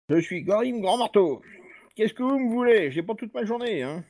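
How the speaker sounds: lightly voiced impatient dwarf; a hint of a gruff dwarf lord; grumpy dwarf. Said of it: "un nain". (nain bourru)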